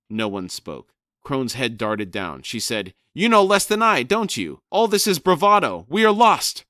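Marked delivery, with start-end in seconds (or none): 3.1-6.7 loud, high furious squawking shout; shouting raven. Acted. Crone shrieking at Brood to find Rake, loud and high. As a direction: scream it at him, higher and louder.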